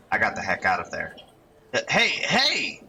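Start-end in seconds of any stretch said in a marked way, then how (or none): 1.7-2.8 high, drawn-out shouted hey; calling out. Acted. Young man shouting after someone slipping into the crowd, calling out. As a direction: call after someone, pitch way up. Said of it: "Guy keeps shouting".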